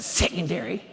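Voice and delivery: "secondary" said apart, first syllable leaned on; pointed word. Retold or imitated. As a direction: imitate her loaded "secondary". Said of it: "That's the way she said it".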